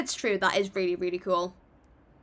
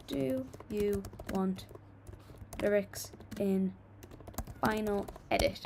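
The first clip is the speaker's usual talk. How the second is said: slow, word by word as she types; slow dictation of a poll question; dictating a poll; 5 dB quieter, slower than usual. Real, not acted. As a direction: spell the poll question out slowly while typing. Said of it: "I'm gonna do a poll for you guys".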